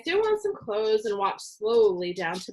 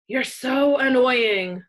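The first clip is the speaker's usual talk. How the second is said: slow, louder growling complaint; growled; 4 semitones higher, 6 dB louder, slower than usual. A narrator's reading. Sister growls at her brother while stomping out, slow and louder. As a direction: drag it out in a growl. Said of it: "Susie growled".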